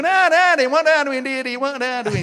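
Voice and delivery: high, loud mock Sheffield child with wide A; Sheffield accent. Child whining to dad in broad Sheffield. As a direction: send up a Sheffield kid calling daddy. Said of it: "they got this wide A".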